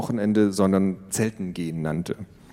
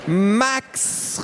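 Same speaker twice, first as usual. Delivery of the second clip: calling out; 12 semitones higher than usual. A narrator's reading. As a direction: shout for her brother. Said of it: "rief Tiffany".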